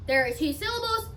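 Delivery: normal talking voice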